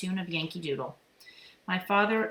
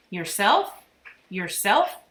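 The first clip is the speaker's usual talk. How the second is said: loud rising bird echo; bird repeating; 2 semitones higher, 8 dB louder, much slower than usual. A narrator's reading. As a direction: parrot the word back as a question. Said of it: "he repeated".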